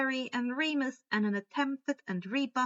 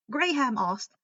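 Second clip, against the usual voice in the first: ordinary delivery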